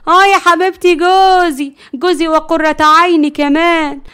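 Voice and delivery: triumphant, loud mock endearment; defiant possessiveness. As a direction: rub it in to the rival. Wife proudly claims husband to rival.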